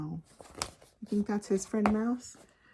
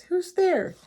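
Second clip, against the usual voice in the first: high, loud Mouse calling out; crying out; 11 semitones higher, 8 dB louder than usual. A narrator's reading. Mouse calls to whoever is at the den, crying out. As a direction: call out in a raised, higher voice. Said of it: "Mouse cries".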